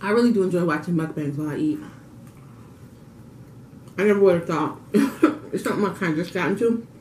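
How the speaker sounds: chewing while talking; talking between bites; mouth full. Real, not acted. Seen 0: Fork-fed bite at 1.9s, chews while talking; food looks like pasta, not pizza.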